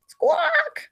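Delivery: very high, thin bird squawk; chicken squawk. Acted. Startled chicken squawking at Samson. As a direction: screech like a startled hen.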